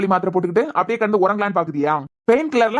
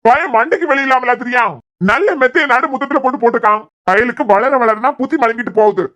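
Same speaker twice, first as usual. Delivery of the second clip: put-on louder, irritated scolding about the boy; annoyed grumbling; 5 dB louder than usual. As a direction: vent your irritation at the grandson, loud. Son scolds about the mattress.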